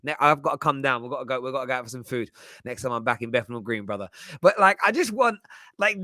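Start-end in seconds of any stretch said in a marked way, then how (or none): none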